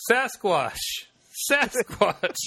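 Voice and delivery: loud high yell, drawn out; bigfoot call yell. Retold or imitated. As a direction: call out into the woods. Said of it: "just yell".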